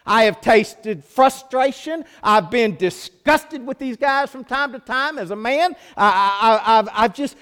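high, loud fervent preaching; preaching at full force. Real, not acted. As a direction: cry out Jesus's suffering to the Father. Preaching Jesus's prayer.

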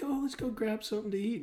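sweetly imitating the ex; mimicking ex-girlfriend. Ex-boyfriend mimics his ex-girlfriend's sweet invite, high voice. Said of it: "she's like".

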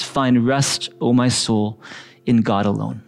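slow, deliberate reading of the verse; meditative slow read-aloud; slow scripture reading. Shown as a demonstration. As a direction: let each phrase sink in slowly. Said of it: "we wanna read it slowly".

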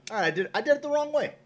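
high, fast, sheepish backpedal; a mock high-pitched climb-down; mock backing down. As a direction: squeak out a hasty apology, half joking. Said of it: "I'm like".

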